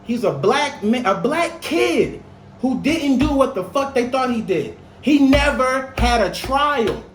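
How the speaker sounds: high, slow and heated; rising indignation, hammering each fact; outraged. Real, not acted. Outrage over wrongful imprisonment.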